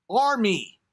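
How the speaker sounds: ARMY pitched high, then dropping; emphatic exclamation. Real, not acted. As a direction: call out "Army!" with relish. Repeats the word as exclamation.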